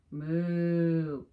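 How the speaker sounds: a loud, drawn-out mock moo; playful cow lowing. Cows typing refrain.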